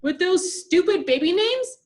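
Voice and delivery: high, loud outraged question; indignant retort. A narrator's reading. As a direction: spit out the insult. Angry sister retorts.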